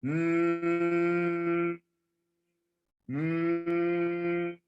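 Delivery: long even hum on one pitch; steady humming. Shown as a demonstration. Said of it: "Then you're supposed to".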